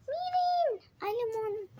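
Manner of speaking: high, delighted shout at spotting lemons; excited cry. Real, not acted. Showing viewers the lemon tree, excited high cry. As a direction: squeal it out like a great find. Excited find in grandma's garden.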